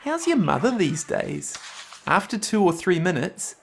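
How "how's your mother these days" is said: casual friendly opener with a lifted name; demonstrating a friendly, casual check-in; friendly small talk. Shown as a demonstration. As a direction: ease in with chatty small talk. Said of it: "start by making friendly conversation".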